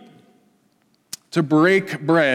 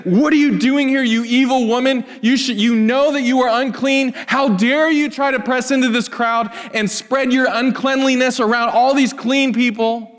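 indignant rebuke; 7 semitones higher than usual. Retold or imitated. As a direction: scold her as an unclean outcast, a hammed-up accusation. Imagined law-abiding rebuke of woman.

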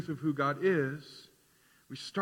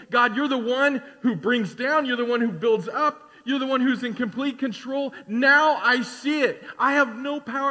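higher, louder acted outpouring of awe; praising God; 6 semitones higher, 5 dB louder than usual. Humbled king praises God before his congregation, exultant praise. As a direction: proclaim it as a humbled king's praise. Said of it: "He praises God and he says".

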